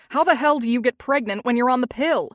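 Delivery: loud, fast angry demand. A narrator's reading. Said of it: "Alec demanded, his voice getting louder".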